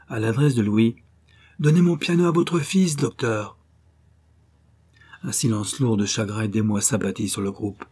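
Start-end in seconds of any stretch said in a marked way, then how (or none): 1.6-3.6 calling out. A narrator's reading. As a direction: shout back a farewell to the doctor. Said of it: "elle cria". (cri d'adieu au docteur)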